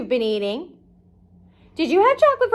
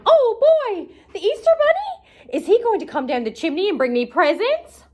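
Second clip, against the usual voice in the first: much higher, giddy cartoon starfish; 6 semitones higher than usual. Patrick imagines Easter bunny presents, high excited voice. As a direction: squeal it out like a thrilled dim friend.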